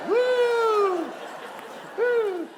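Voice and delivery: high, loud whooping cheer; mock whoop. Host cheering to win over the bouncer, loud. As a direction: whoop it up to get past.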